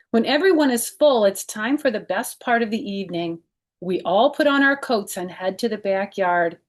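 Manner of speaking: lively read-aloud storytelling voice; children's book narration; story narration. Acted. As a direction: read it to kids, bright and clear. Reads picture book aloud.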